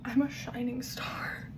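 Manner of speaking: whispered, slow, wry self-mockery; slow sarcastic whisper. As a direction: breathe "I'm a shining star" like a secret. Sweaty, joking about herself.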